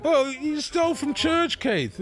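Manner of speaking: high, drawn-out retold scolding; exasperated telling-off, played for laughs; mock reproach. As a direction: act out being appalled at a mate.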